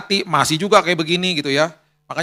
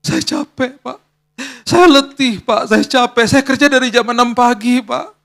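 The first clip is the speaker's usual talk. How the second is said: exhausted courier tearfully explaining to the pastor; 6 semitones higher, slower than usual. Retold or imitated. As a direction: beg tiredly, close to tears. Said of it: "Tiba-tiba dia nangis".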